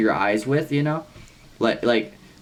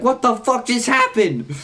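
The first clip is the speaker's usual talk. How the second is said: high, stretched cry of disbelief; retold shock; 13 semitones higher, 3 dB louder than usual. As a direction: mimic stunned friends, slow and high-pitched. Friends' retold shocked reaction.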